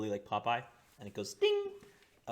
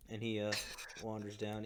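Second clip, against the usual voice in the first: normal talking voice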